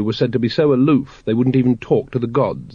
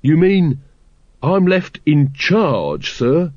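higher, slow, grinning disbelief; unbelieving delight; 5 semitones higher, much slower than usual. A narrator's reading. Carrot learning the captain leaves him in charge, delighted disbelief. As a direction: ask it through a slowly widening grin. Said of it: "unbelieving grin".

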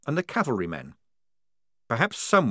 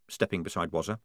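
ordinary delivery, nothing marked

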